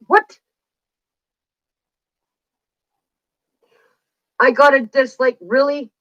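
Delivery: shocked high What, then indignant; real dismay at a dislike; surprised. Real, not acted. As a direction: caught off guard by a dislike. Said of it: "reacting to a new dislike".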